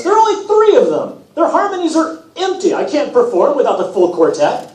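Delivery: a pop-star god sulking about his backing singers; vain god Apollo. Acted. Apollo's complaint, high voice.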